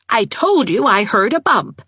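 high, indignant I-told-you-so; insistent. A narrator's reading. Aunt insists she was right.

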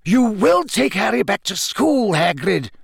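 high, forceful command; insist, allowing no argument; Dumbledore, firm. A narrator's reading. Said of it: "Dumbledore repeated firmly".

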